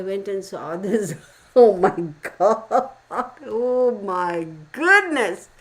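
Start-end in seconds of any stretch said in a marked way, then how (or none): 1.6-5.5 loud, stretched oh my goodness; real shock. Real, not acted. To viewers, reacting live to the video, loud drawn-out shock. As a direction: gasp it out, genuinely floored.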